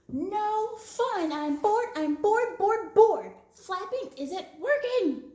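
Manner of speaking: high, loud whiny tantrum; tantrum yell. Acted. Bird throws a tantrum, loud whiny yell. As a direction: stamp and whine like a bored child. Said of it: "Bird yelled".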